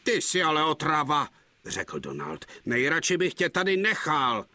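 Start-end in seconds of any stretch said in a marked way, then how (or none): 0.0-1.3 an annoyed twin snapping, louder; angry. Acted. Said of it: "se zlobil". 2.7-4.4 an annoyed twin snapping, louder; angry. Acted. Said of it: "se zlobil".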